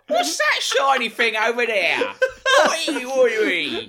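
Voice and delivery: slow, loud, high English caricature; mock English accent. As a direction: bellow it as a silly English caricature. Mock English riff after accent remark.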